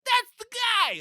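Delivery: very high, sudden cop cry; excited cop shout. Retold or imitated. Cops spot the camo pants, in a very high excited cry. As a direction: spot him and call it out. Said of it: "And they're like".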